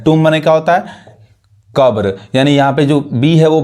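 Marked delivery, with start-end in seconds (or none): none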